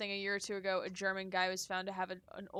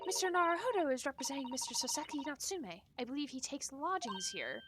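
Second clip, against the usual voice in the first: lightly put-on, higher formal voice; lightly voiced prim assistant; polite assistant character; 4 semitones higher, 4 dB quieter, faster than usual. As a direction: introduce your colleague courteously, a little higher.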